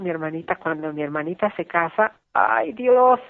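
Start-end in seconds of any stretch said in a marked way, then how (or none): none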